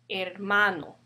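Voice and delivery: "hermano" stressed on MA; stress on second-to-last syllable. Shown as a demonstration. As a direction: demonstrate "hermano" as her-MA-no. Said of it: "the second to the last syllable would be".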